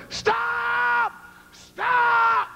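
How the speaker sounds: high shouted warning; retold scream. Man on the highway warning drivers, high desperate shout. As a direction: scream it like a man flagging down cars.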